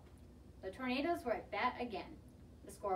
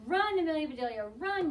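high, loud urgent yell; 4 semitones higher, 7 dB louder than usual. A narrator's reading. Boys to the batter, yelling high and loud. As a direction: yell her on down the line. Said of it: "yelled the boys".

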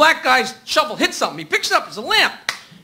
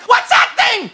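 scared wife; 8 semitones higher, slower than usual. Acted. Wife panics at hamster.

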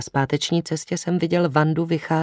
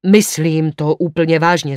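loud, fervent insistence; emphatic outburst at raised volume; half-senseless exclamation; 7 dB louder than usual. A narrator's reading. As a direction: cry it out, half out of your senses. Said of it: "zvolal jsem z pola beze smyslu".

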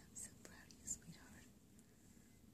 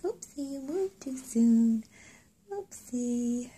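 surprised oopsie; 5 semitones higher, 13 dB louder, slower than usual. Real, not acted. Pet moves during check.

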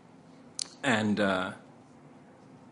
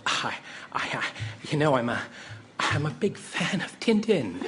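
stammer, then burst out high and loud; flustered mock gushing, slowing on the last phrase; tongue-tied fan; 5 semitones higher, 3 dB louder than usual. Starstruck actor blurting to a famous director, tongue-tied. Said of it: "became totally lost for words".

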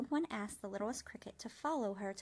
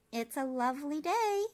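a bright, louder call across the pond; old spider calling out kindly; 6 semitones higher, 7 dB louder than usual. A narrator's reading. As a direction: call it out cheerfully to the cricket. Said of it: "the old one called out".

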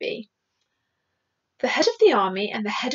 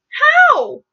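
high, loud one-word demand; exasperated military exclamation; incredulous outcry; 7 dB louder than usual. Acted. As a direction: burst out with it, pitched way up. Military men exclaim in protest.

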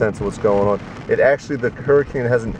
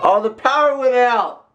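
high fake panic about the power; fake alarm; 13 semitones higher, 3 dB louder than usual. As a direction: pretend the power just cut. Prank on wife in shower.

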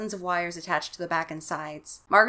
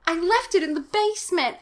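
high-pitched dismayed wail; hammed-up boyish dismay; horrified exclamation; 11 semitones higher, 4 dB louder than usual. A narrator's reading. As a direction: confess it in rising panic. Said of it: "Casey exclaimed".

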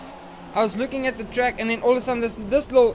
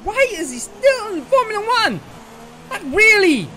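much higher, louder indignant yelling; angry rant; 9 semitones higher, 5 dB louder than usual. Real, not acted. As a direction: vent at the driver ahead. Ranting at rival driver.

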